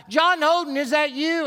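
hollering to someone far off; retold yell. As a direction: call out across the fog. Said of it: "he yelled out".

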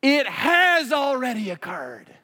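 high, loud, slow shout; scream. Retold or imitated. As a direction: hurl it back at him. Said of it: "I wanted to scream".

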